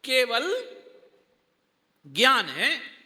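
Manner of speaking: केवल rising, long gap, then firm ज्ञान है; pause after केवल. Shown as a demonstration. Teacher telling listeners to know it clearly, pause after केवल. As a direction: hold a clear break between केवल and ज्ञान है. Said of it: "यहां कह रहा है स्पष्ट जानो".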